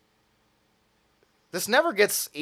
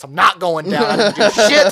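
rising, louder defiant vow; mock defiance; 6 semitones higher, 11 dB louder than usual. Bloodied teen to his teacher, defiant and loud. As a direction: dig in like a wronged kid.

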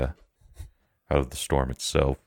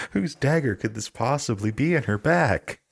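mock innocent; 11 semitones higher than usual. Mock-innocent accusation in character.

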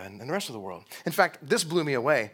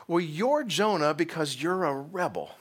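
usual speaking voice

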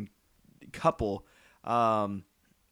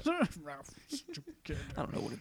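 creepy mutter; 6 semitones higher, 10 dB quieter, slower than usual. Retold or imitated. Mocking the sheriff.

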